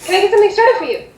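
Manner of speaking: high, quick hostess voice; eager hostess. Acted. Hostess asks guests for their order, quick and high. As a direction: offer service in a rapid, lifted voice.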